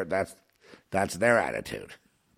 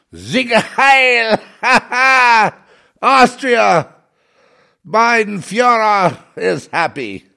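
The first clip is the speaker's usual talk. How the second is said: much higher and louder hammed-up rally shout; mock rally cheer, slow at the end; mock Nazi cheer; 13 semitones higher, 11 dB louder, much slower than usual. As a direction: bellow it like a parody fascist crowd. Parodying Austrian government cheering.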